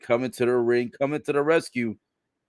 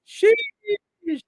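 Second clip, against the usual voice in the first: very high, stretched-out exclamation; high-pitched sheesh; 3 dB louder than usual. Real, not acted. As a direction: squeal it out in amused shock. Reacting to a spicy retort.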